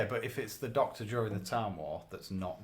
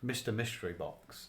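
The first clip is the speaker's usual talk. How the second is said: normal talking voice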